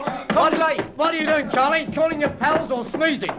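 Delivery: high-pitched jeer mid-brawl; fight taunt. Acted. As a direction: taunt him in the thick of a fight. Taunting villain during a fist fight.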